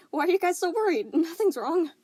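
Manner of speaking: high, loud false brightness; forced cheer. Acted. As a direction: pretend all is fine. Forced cheer hiding panic.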